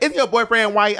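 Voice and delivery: put-on high-pitched, botched Texas accent; mock Texas accent, not quite landed; Asia O'Hara. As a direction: imitate a friend's Texas twang, pitched well up. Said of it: "I can't do her Texas accent".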